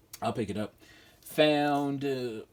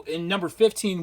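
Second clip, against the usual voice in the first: ordinary talk, nothing marked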